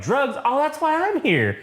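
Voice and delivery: high, put-on woman's voice. Woman with the drugs answers man, high imitated voice. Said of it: "and she's like".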